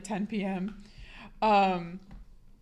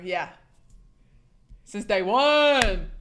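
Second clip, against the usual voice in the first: stretched out, slow and louder; drawn-out boast; 4 dB louder, much slower than usual. Real, not acted. Streamer owning up to being a scammer, drawn-out boast. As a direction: draw it out proudly, voice swelling.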